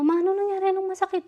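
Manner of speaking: high, anxious voice of a daughter asking what hurts; worried daughter. Retold or imitated. Daughter finding her crying mother outside the clinic, asking anxiously.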